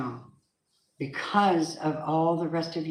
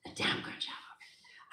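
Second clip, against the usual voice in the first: breathy whispered repeat; whispered echo; 14 dB quieter than usual. Shown as a demonstration. Reader whispers God's words back to the listener. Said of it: "God says".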